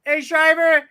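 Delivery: high, loud call across the lot. Retold or imitated. Fan calling out to a player, loud and high. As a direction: shout his name to get his attention. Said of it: "I was like".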